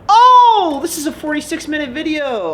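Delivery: very high, loud startled yelp; shocked squeal. Real, not acted. Vlogger notices how long the video runs, squealing high. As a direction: squeal in mock horror at the time.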